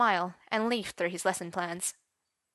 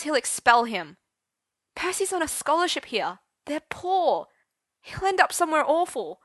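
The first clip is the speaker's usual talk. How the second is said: loud, high distressed cry; desperate upset shout; distressed outburst; 7 semitones higher, 7 dB louder than usual. Acted. Arthur's outburst continues.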